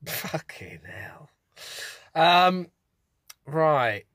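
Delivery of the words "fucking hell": whisper. Real, not acted. Aside reaction.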